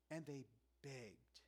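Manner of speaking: a quiet, slow echo of the quote; soft slow repeat. Shown as a demonstration. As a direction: let "And they begged" sink in, hushed and slow. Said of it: "This struck me fresh".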